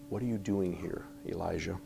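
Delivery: gentle whispered question to Elijah; low whisper of God. Acted. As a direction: breathe it like a still small voice. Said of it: "the sound of a low whisper".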